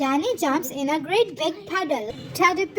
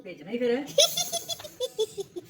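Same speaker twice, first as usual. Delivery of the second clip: quiet genuine giggle; soft real laugh; 5 semitones higher, 5 dB quieter than usual. Real, not acted. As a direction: laugh softly to yourself. Laugh after burp.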